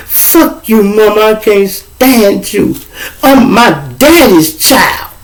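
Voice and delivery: put-on high, slow, taunting teen girl voice; bratty daughter. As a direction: sneer it at mom like a spoiled daughter. Daughter taunting her mother.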